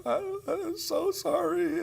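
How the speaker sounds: high, slow, tearful sobbing apology; choked-up high girl's voice, pleading; imitating a crying girl. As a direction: play the sobbing teenager saying sorry. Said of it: "And she's crying".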